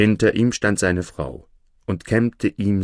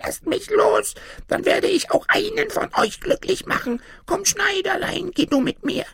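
very high, squeaky pleading voice; pitiful tiny man. A narrator's reading. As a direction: plead pitifully as a trapped little gnome. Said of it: "tat aber ganz erbärmlich und schrie". (erbärmlich flehendes Männlein, hoch piepsend)

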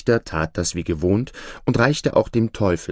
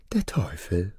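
dropped, softer devil voice; devil's reveal; 3 semitones lower, 6 dB quieter than usual. Acted. Devil naming himself to the judge, hushed reveal. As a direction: let the name fall low and quiet.

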